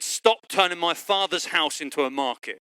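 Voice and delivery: usual speaking voice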